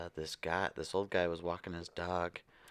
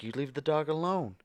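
imitated scolding; 7 semitones higher, faster than usual. Couple scolding the dog owner.

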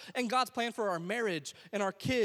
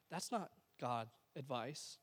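softer and slower, letting "not" land quietly; 7 semitones lower, 11 dB quieter, slower than usual. Acted. Pastor quietly restating to the congregation, softly. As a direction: drop it low and let it sink in.

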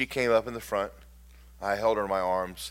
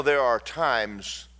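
ordinary talk, nothing marked

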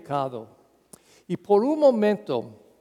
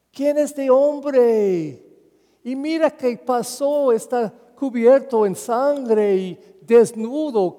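shocked newcomer; 5 semitones higher, 4 dB louder than usual. Acted. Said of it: "y buscó diciendo".

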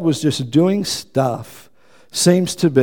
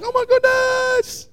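much higher, slow, stretched-out gasp of alarm; mock panic; slower than usual. Imagining a lost purse, gasping in mock panic. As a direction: play someone who just found their purse missing. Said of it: "You'd be freaking out".

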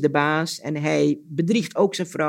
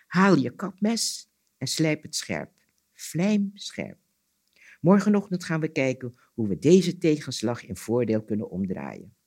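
measured storyteller's reading voice; story narration; 3 semitones lower than usual. A narrator's reading. Storyteller reading an Anansi tale aloud, story narration. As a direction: tell it as a folk tale, pausing between sentences.

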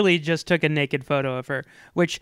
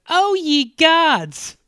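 high, loud, drawn-out mock shock; hammed-up cry of horror; shocked exclamation; 11 semitones higher, 6 dB louder, much slower than usual. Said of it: "Like, when he's shocked".

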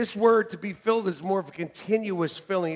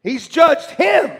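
loud, high shout of denial; a loud, slow, preaching shout; 9 semitones higher, 11 dB louder, much slower than usual. Real, not acted. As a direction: shout it down from the pulpit. Preaching at full force.

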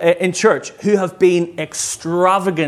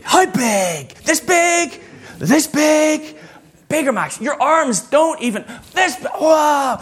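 put-on high, shouting kid, amazed at the size; excited little boy; 11 semitones higher, slower than usual. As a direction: play a five-year-old boy losing it over a huge present. Dad voices excited child Max.

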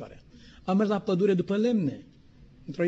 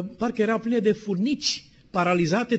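usual speaking voice

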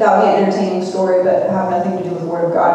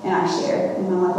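ordinary delivery

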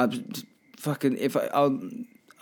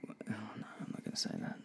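1 whispered aside; 19 dB quieter, much faster than usual. Real, not acted. Muttered aside to self, unvoiced.

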